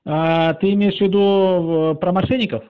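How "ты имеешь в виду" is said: ordinary talk, nothing marked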